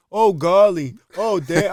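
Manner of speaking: hammed-up old man going oh golly. Friend imitates late buddy as an old man. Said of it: "He'd be like".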